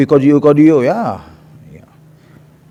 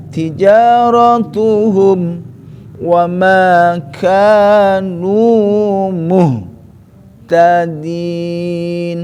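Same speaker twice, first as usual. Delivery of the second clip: bacaan tilawah; much slower than usual. Shown as a demonstration.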